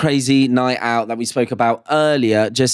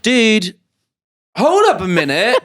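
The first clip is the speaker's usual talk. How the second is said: higher, louder outraged objection; indignant protest; 7 semitones higher, 3 dB louder than usual. Retold or imitated. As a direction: call out the guard in disbelief. Retold protest to security guard.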